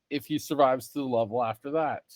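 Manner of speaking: ordinary delivery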